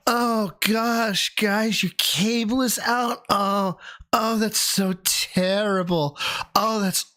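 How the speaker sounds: high, slow, fake-sorry customer service voice; mocking cable company in the South Park episode, high and sickly sweet; South Park cable guy. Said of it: "South Park cable industry episode".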